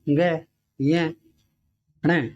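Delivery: ग, ये, ण spelled out one by one, very slowly; slow letter demo. Shown as a demonstration. Teacher to students, slowly sounding out nasal letters. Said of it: "इनको बोलते हैं ग".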